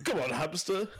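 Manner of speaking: laughing presenter jibe; hammed-up tease; mock Clarkson. Clarkson voice teasing.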